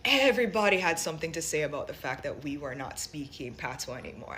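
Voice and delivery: ordinary talk, nothing marked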